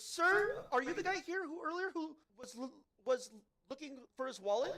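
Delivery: lady's voice pitched high and light; imitating the lady. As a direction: put on a polite store clerk's voice. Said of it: "then finally she says".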